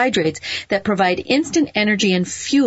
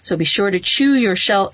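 normal talking voice

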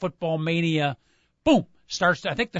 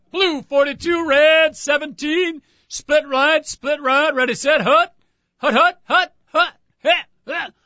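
quarterback calling cadence; 10 semitones higher, 6 dB louder, slower than usual. Pretend. Mock quarterback snap count.

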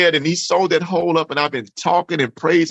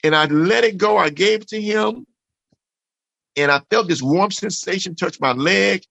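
ordinary talk, nothing marked

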